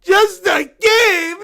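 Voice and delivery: outraged shout. Acted. Bear outraged at mom.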